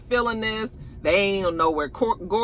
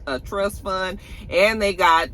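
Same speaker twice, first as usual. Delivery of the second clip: ordinary talk, nothing marked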